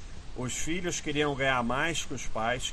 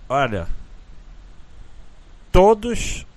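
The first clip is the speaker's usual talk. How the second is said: ordinary talk, nothing marked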